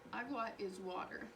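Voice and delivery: ordinary talk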